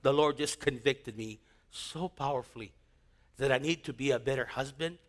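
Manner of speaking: low, soft and heartfelt; soft sincere testimony. Real, not acted. Preacher softly testifying how God changed his life. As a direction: speak it gently, as if it still moves you.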